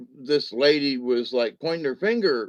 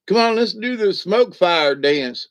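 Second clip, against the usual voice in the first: loud, higher call to dance; rally them with a raised voice; playful rallying call; 4 semitones higher, 6 dB louder than usual. Pretend. Calling everyone to the smoke fire dance, playful rallying call. Leads into a mock fire chant.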